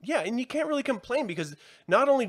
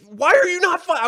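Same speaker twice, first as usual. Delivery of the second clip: exasperated shout, much higher and louder; 9 dB louder than usual. Real, not acted. Fan venting about troops not firing, shouting. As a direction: shout the question in disbelief. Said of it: "I was shouting at the T V".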